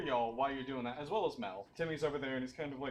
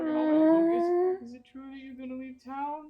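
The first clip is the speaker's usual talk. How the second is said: put-on high, pleading child voice near tears; sad little kid; 14 semitones higher, 6 dB louder than usual. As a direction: beg them not to leave like a tearful kid.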